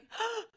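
sharp gasp of relief, acted; quick high intake of breath; re-enacted gasp. Retelling hearing a friend's voice, gasping.

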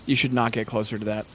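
low, hushed dread; grave, low voice with heavy pauses; ominous game master narration. Acted. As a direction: let the danger sink in, low and soft. Game master voices dawning realization.

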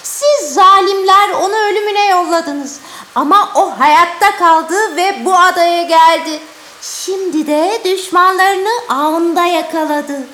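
high, loud otherworldly judgment; avenging spirit voice. Acted. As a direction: pronounce doom like an angry spirit. Ariel pronounces judgment.

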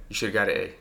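flat, cold dismissal of a good grade. Retold or imitated. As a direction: say it coldly, like a stern dad unimpressed. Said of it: "Cold like that".

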